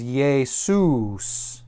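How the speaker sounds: "Jesus" drawn out as yay-SOOS; "Jesus" as Greek Iēsous, said slowly; Greek pronunciation. Shown as a demonstration. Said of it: "Yeshua becomes Jesus".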